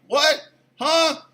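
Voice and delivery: high, baffled yelp; acted-out perplexed husband; startled reply. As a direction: pipe up in confusion. His startled reply, retold.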